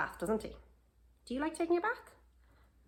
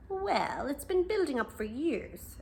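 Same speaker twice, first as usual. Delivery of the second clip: fox explaining; 7 semitones higher than usual. A narrator's reading. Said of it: "explained the fox".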